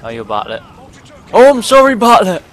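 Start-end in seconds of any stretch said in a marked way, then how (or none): 1.3-2.4 high, loud cry of dismay; horrified apology. Real, not acted. Player apologising to teammate he shot, high horrified yell. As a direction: blurt it out, pitched way up. Just killed his own teammate.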